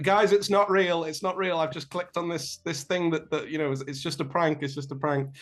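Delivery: acted urgent reassurance, high-pitched; urgent calling out. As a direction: call out to friends in a rush of alarm, then ease a little. Friend calls out that it's fake.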